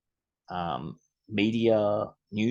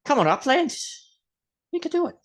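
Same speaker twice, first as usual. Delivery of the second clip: much higher, pleading cheer; faster than usual. Acted. Host urging Upland to get partners' help, high coaxing plea. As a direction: egg them on in a high coaxing voice.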